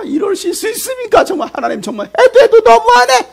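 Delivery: very high, impassioned complaint to God; outraged protest. Acted. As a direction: plead and protest at the top of the voice. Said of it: "그거에 대해서 모세는 또 뭐합니까".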